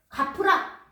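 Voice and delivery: a stern master barking for payment; demanding shout. Acted. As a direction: shout the order like a harsh lord.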